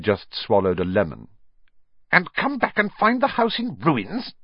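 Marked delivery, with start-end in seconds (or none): 2.1-4.3 high-pitched snarling question; snarled. A narrator's reading. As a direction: snarl it back as an accusation. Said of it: "she snarled".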